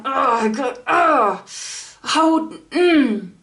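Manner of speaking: retold exasperated outburst of ughs; mad and defeated. Reliving the exploded tyre, mad and defeated. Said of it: "I was so mad. I was so defeated".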